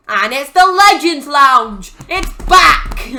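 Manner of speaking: high, loud triumphant announcement; excited reveal. Acted. Narrator reveals the Legends Lounge is back, excited shout. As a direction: shout the big reveal, hammed up.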